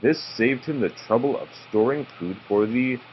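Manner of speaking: ordinary delivery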